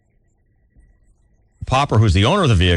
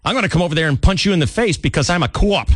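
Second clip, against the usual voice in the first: a higher, mock Boston threat; hint of a tough Boston cop; Boston tough-guy accent; 8 semitones higher than usual. Wahlberg's bad cop threatening someone, in a mock Boston accent. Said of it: "Mark Wahlberg plays this bad co-op".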